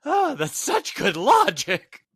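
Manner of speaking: high, slow sarcastic gushing; mock sarcastic praise. Teasing a co-host with high, slow sarcastic praise. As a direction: overdo the praise so it mocks.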